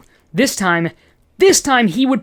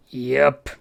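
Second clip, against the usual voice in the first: darkly; 4 semitones lower than usual. Acted. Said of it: "he said darkly".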